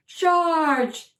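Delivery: high, loud battle cry; yelled. Acted. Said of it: "yelled the knight".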